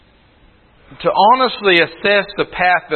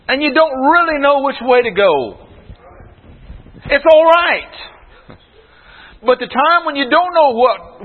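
preaching; 7 semitones higher than usual. Real, not acted. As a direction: build the sermon up to a commanding stop. Preaching builds to a call.

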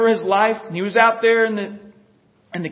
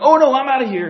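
louder, higher hasty bail-out; mock alarm; 4 semitones higher, 7 dB louder, faster than usual. Elijah flees on hearing of Jezebel, mock alarm. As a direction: play Elijah wanting out, raised voice.

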